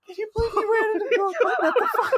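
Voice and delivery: very high, fast tearful squeal; squeaking and sobbing with laughter; crying with laughter. Acted. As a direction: rush the words through helpless laughter. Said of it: "I'm crying right now".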